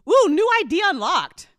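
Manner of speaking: high, loud cheer at a new idea; excited. Real, not acted. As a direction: cheer like you just leveled up. Excited by live show idea.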